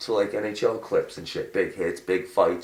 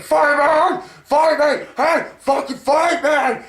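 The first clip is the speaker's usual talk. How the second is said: high, loud goading yell; mock shout; 5 dB louder, slower than usual. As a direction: scream for a fight, high and loud. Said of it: "Emery's gonna be like".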